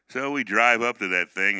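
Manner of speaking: normal talking voice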